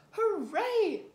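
loud high cheer; big happy hooray. A narrator's reading. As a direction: cheer like a delighted little pig. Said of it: "cheers Peppa".

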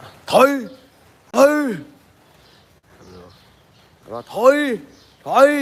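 high, loud order to back off; commanding shout to an elephant. Shown as a demonstration. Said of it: "เราเปล่งเสียงออกไปประมาณว่า".

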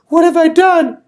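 high, loud desperate plea; pleading. Acted. Missus Molasses pleading before the king, high and desperate. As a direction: beg the king for mercy, frantic. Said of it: "She kept pleading".

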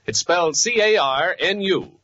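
normal talking voice

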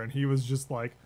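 ordinary talk, nothing marked

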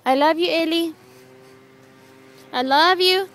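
loud, clear sing-song prompt; loud toddler prompting. Shown as a demonstration.

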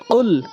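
কুল with q from the back of the tongue; correct qaf qul. Shown as a demonstration. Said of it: "কুল বলতে হবে".

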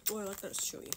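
ordinary delivery

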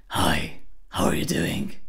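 low raspy rattle on casual small talk; rattled greeting. Shown as a demonstration. Greeting friends with the exercise voice, rattled greeting. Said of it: "what's with your voice?".

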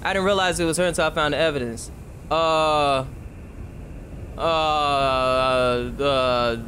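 normal talking voice

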